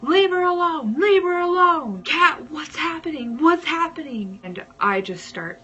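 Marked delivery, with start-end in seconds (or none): 0.0-4.4 high, loud panicked shout; imitated desperate screaming; boyfriend screaming. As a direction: yell out as a frantic boyfriend. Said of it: "my boyfriend at the time screaming".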